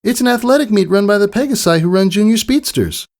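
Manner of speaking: high, bright-eyed filly voice; a proud young filly sharing big news; eager young pony girl; Scootaloo. Acted. Scootaloo explaining the meet.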